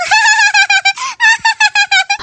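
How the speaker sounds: high rapid cackle; mocking laughter. Friend laughing at bird droppings on her head, mocking. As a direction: burst into high, teasing laughter.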